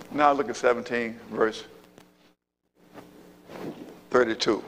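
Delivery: ordinary talk, nothing marked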